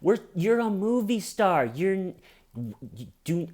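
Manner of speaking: high-pitched, incredulous scolding; exasperated PR manager. Retold or imitated. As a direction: chew out a star client in disbelief. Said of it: "I always picture their P R manager".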